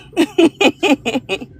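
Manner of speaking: high, loud burst of giggles; amused laugh. Real, not acted. Livestreamer tickled by her old moo-moos plan, laughing high. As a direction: crack up at your own joke.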